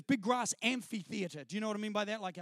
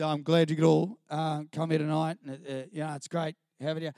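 lower, louder singer addressing the crowd; a lightly voiced singer, lower and louder into the microphone; lead singer on the mic; 5 semitones lower, 4 dB louder than usual. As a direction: play the earnest church singer at the mic. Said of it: "he said".

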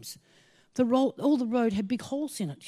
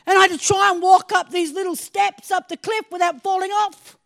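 loud, raised preaching; preaching at full force; 5 semitones higher, 9 dB louder than usual. Acted. Preacher urging the congregation, at full force. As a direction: preach it out, building.

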